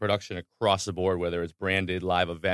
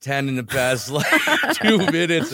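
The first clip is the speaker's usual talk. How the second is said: laughing; 7 dB louder than usual. Real, not acted. Laughing about Pixie Stix.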